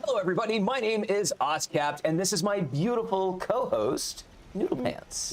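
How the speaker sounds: high put-on voice of his co-host, quick; co-host's voice. Host introducing his co-host in her voice, high and teasing. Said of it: "She says I can't do her voice properly".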